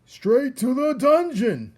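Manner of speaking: very high, loud, slow mob cry; shouted. Acted. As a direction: yell it from the back of the crowd. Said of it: "another voice shouted".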